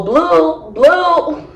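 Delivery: drawn-up name call at normal volume. Retold or imitated. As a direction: imitate a brother hollering across a park. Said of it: "calling him out of the park".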